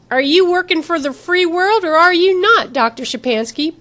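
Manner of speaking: high, loud pointed question; confrontational challenge. A narrator's reading. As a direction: challenge their loyalty head-on. Corporal challenges a hostile scientist.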